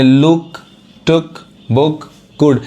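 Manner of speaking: लुक, टुक, बुक, गुड with a short "u"; short sound. Shown as a demonstration. Teacher explaining short sound, brief "u" in लुक, टुक, बुक, गुड. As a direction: separate words, quick vowel in लुक, टुक, बुक, गुड. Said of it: "लेकिन fast पढ़ा रहा है".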